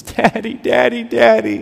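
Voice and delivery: put-on high, pleading little boy calling Daddy; a toddler's distressed cry for Daddy, lightly voiced; child crying out. Toddler son fallen on his face calls for his dad, high pleading. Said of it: "And he says".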